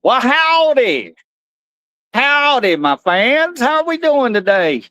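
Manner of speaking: high, slow cowboy drawl; hammed-up high cowboy voice; Cowboy Carl. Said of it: "Cowboy Carl is in the house".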